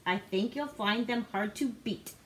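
ordinary delivery, nothing marked

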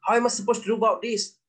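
high, fast, flustered officer voice; mock-panicked British officer at a loss; flustered British soldier. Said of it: "askar British cakap macam tu".

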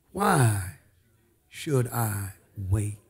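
WHY drawn out, then SHOULD I WAIT slow and low; driving it home. Shown as a demonstration. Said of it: "Drive that home".

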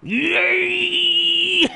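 high squeal of delight, played up; mock toddler cheer. Dad imitating his toddler's gleeful yell. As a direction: squeal it like a toddler refusing to give a toy back. Toddler grabbing toy retold.

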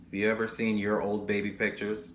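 plain reading voice

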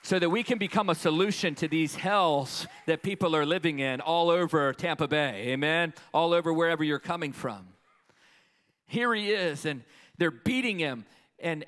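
loud, driving preacher's call; rally the congregation; full-force preaching. Real, not acted.

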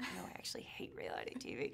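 hushed, quick whisper; whispered quick aside; whispered reply. Retold or imitated. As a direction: whisper it like a confession. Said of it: "I was like".

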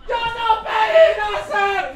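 high, drawn-out mock yell; teenage tantrum scream. Child shouting at a parent at night, high drawn-out scream. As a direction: scream it slowly like an aggrieved child. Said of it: "me grita en la noche".

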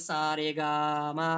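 long held সা রে গা মা; সা রে গা মা said slowly, each syllable lengthened; drawn-out notes. Shown as a demonstration. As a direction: stretch out the vowels in সা রে গা মা. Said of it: "কখনো সে দীর্ঘ সময় নিয়ে বলছে".

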